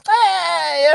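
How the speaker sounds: high, drawn-out mock cry; mock wail. Neighbor imitating his reply to the police, mock wail.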